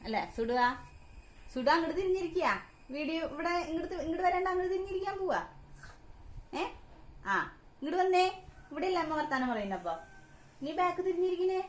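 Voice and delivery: high sing-song coaxing of a little one; baby talk. Acted. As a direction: coax the baby, high and bright. Mother calling baby back to camera. (കുഞ്ഞിനോട് കൊഞ്ചി സംസാരിക്കുന്നു)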